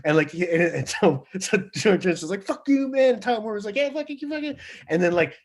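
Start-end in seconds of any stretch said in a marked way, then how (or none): 2.4-3.1 much higher hammed-up fuck you; mock angry retort. Guitarist swears at the producer, mock anger. 3.8-4.6 much higher, half-laughing mock comeback; mock retort back. Producer throws the insult back at the guitarist, mock retort.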